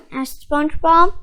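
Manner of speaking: plain reading voice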